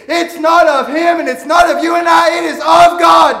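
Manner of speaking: high, loud, fervent preacher's cry; fiery pulpit shout, slowing on the last line; preaching at full force. Acted. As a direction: shout it to the congregation like a revival preacher. Preaching peak.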